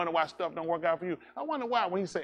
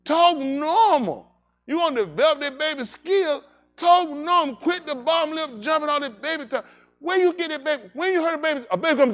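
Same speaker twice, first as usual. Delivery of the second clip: put-on baby scolding adults; 11 semitones higher, 6 dB louder than usual.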